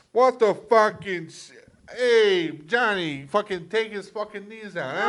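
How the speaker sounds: loud, high mock New York mobster; New York accent. Mob boss ordering Johnny about, loud mock New York accent. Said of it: "you go to fucking New York".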